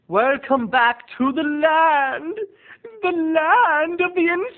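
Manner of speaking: high, loud, stretched-out wail; eerie high-pitched proclamation, rising and drawn out; theatrical spooky host. Acted. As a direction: welcome them like a crazed horror host. Theatrical welcome to recurring segment.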